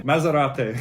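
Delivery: "Maserati" ending in "tay", stressed RAH; Italian pronunciation. Shown as a demonstration. Presenter repeats the name as Italian, Italian pronunciation. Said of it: "Again, Italian".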